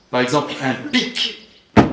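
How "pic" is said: PIC short and sharp with crisp consonants; a sudden, pointed "pic"; demonstrative articulation. Shown as a demonstration. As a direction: let "pic" sound like what it means. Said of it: "les mots signifient très souvent ce que".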